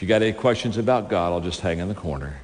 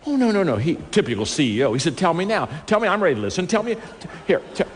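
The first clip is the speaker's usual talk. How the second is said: high, pressing voice of an eager boss; eager CEO; 10 semitones higher, faster than usual. Retold or imitated. Preacher voicing the CEO begging to hear, high and pressing. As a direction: play the impatient executive demanding an answer.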